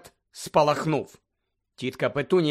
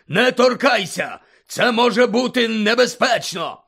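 loud, higher alarmed yell; alarmed shout; 7 semitones higher, 6 dB louder than usual. Acted. Uncle warning his wife, alarmed shout. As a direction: shout her away from the letter. Vernon warns of danger.

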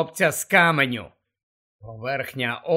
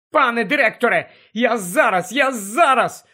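quick, higher, frantic babble; desperate babbling; 5 semitones higher, 3 dB louder than usual. Acted. Harry to dying Dumbledore, babbling in despair. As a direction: gabble in despair. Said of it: "у відчаї белькотів Гаррі".

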